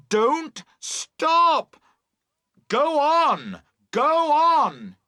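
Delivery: full high-pitched urgent shout. A narrator's reading. Boatman urging girls to keep towing, shouting. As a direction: yell each command, drawn out.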